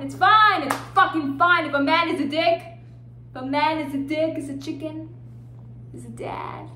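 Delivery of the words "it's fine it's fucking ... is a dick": sudden high, loud shout; angry outburst. Acted. Woman insists it's fine, shouting angrily. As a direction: burst out loudly on the insistence. False acceptance breaks into outburst.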